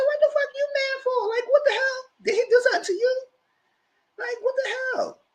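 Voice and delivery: very high-pitched, comic disbelief; mock incredulous. Host re-enacting a reply to his anger, comically incredulous. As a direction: squeal the questions in exaggerated bafflement. Mimics own baffled reply to angry man.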